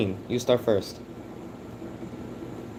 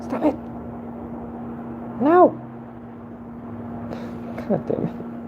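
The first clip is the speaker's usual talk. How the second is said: much higher pleading squeals; shrieking protest. Real, not acted. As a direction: squeal at them to stop. Alarmed at someone's actions.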